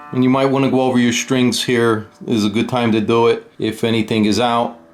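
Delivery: ordinary talk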